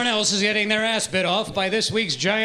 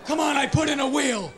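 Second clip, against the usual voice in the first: chasing shout; 5 semitones higher, 4 dB quieter than usual. Acted. Chasing the gerbil.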